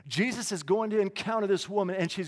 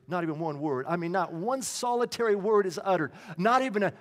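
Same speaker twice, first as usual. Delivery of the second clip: ordinary delivery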